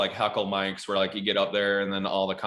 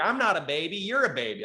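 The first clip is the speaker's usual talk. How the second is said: put-on higher childish comeback; kid's comeback; 7 semitones higher than usual. Kid fires back at the comic in a childish voice. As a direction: talk back like a stung kid.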